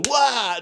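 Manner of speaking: high loud attack cry; battle yell. Retold or imitated. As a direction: yell as you strike.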